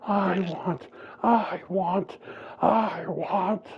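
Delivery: high loud repeated crying words; crying rage. Retold or imitated. Jalen charging his attack, crying with rage. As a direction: sob out the words while building power.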